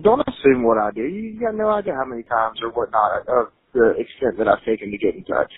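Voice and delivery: usual speaking voice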